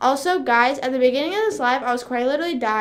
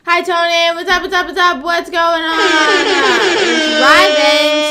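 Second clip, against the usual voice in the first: high, loud hype hello; excited shouted greeting; 6 semitones higher, 10 dB louder, slower than usual. Real, not acted. Streamer greeting Tony as they stop in, excited shout. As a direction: holler a welcome, stretching out the end. Loudly greeting a viewer arriving.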